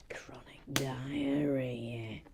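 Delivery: slow hushed whisper; whispered aside. Real, not acted. Whispering the colour verdict to viewers. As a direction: breathe it out in disbelief.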